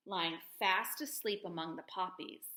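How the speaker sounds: ordinary delivery, nothing marked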